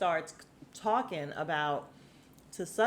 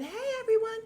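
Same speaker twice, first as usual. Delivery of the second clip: high-pitched imitation of a tipsy holler; shrill-pitched mock party shout, much higher than usual; mock drunk scream. Imitating a drunk guest greeting the party, mock scream. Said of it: "because she's screaming".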